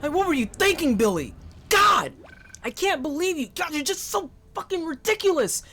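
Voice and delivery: a parent who can't believe the kid, hammed up; scolding parent. A parent yells at the child who built the shape, hammed-up scolding.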